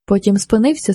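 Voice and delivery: ordinary delivery, nothing marked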